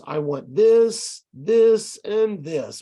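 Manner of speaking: loud stretched "this", rising on the first two, "and this" settling down; series intonation. Shown as a demonstration. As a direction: list it out, punching each "this" and lifting until the last. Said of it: "if it's a series of things".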